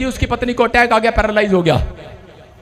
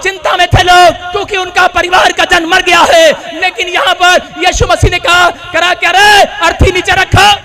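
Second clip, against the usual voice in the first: raised, high revival voice; impassioned preaching; 8 semitones higher, 5 dB louder than usual. Acted. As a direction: declare the story forcefully to the crowd. (जोश से ऊँची आवाज़ में उपदेश)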